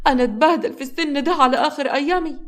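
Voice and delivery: put-on milk the old-age pity; fake crying. Grandmother pleads to her son, mock weeping. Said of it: "جليلة بتمثل العياط".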